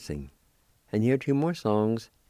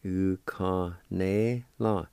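slow, syllable by syllable; slow demo; much slower than usual. Shown as a demonstration. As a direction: stretch U-ka-ne-la out slowly, each syllable clear. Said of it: "And it's pronounced like this".

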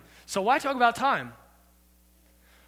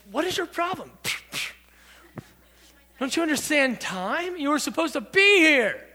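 raised pitch, exasperated outburst; indignant scolding; 6 semitones higher than usual. Acted. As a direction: scold a latecomer, incredulous and high. Acts out annoyed Westerner at latecomer.

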